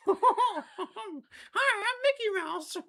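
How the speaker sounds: high squeaky cartoon mouse; Mickey Mouse. Girlfriend requests Mickey, so a squeaky cartoon greeting. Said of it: "Do a Mickey Mouse voice".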